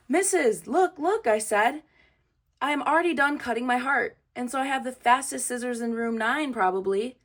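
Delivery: eager showing off. A narrator's reading. Junie shows off to teacher.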